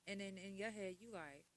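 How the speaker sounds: usual speaking voice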